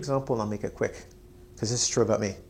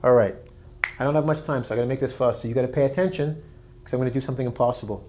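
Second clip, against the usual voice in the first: ordinary talk, nothing marked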